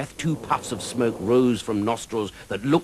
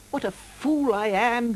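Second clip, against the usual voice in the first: miserable self-reproach; slower than usual. Acted. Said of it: "he thought miserably".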